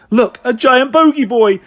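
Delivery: high, loud playground shout; excited schoolchild's holler; shouting child. Acted. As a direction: yell it out, pointing out the spectacle. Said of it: "shouted one child".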